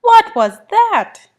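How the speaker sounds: high, loud, slow amazement; astonished little kitten; startled question. A narrator's reading. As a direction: gasp out the question in shock.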